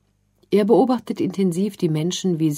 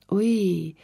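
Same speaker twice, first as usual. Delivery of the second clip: a small, sad, higher "Ui"; traurig; 4 semitones higher than usual. A narrator's reading. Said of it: "entgegnet Malte da nur traurig". (kleines, trauriges Ui)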